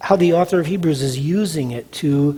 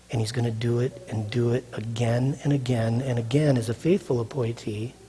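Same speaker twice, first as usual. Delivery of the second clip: usual speaking voice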